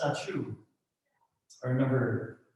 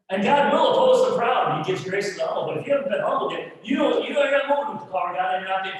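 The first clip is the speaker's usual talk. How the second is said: higher and louder, building to a shout; preaching at full force; 7 semitones higher, 5 dB louder, faster than usual. Acted. Preacher rousing the church about humility, in a full-force shout. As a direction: drive the point home, fast.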